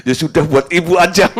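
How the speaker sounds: amused, laughing through the words. Real, not acted. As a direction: say it while chuckling. Laughs at his own reply. (sambil tertawa geli)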